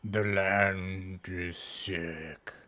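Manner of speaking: low, soft, extremely drawn-out words; sick tree. Acted. Tree telling what is wrong, deep slow groan. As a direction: groan it slowly like an ailing tree.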